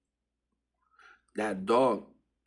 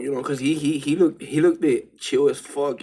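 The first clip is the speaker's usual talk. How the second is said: louder, higher, bubbling over; excited; 4 semitones higher, 8 dB louder than usual. Real, not acted. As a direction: build it up, amused. Laughing excitedly about the dog.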